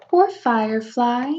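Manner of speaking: ordinary delivery, nothing marked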